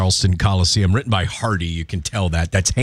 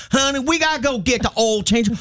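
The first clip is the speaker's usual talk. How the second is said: high, hammed-up Southern wife; mock Southern accent; 13 semitones higher than usual. Imagined Southern spouse in mixed household, high mock twang. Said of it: "can you imagine Pennsylvania and that".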